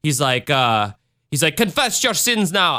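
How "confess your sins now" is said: higher, hammed-up Jamaican accent; bad Jamaican accent. Podcaster imitating the attacker's gibberish rant, bad Jamaican accent. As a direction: do a poorly faked Jamaican preacher-madman. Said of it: "I cannot do a Jamaican accent".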